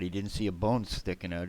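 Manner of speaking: ordinary delivery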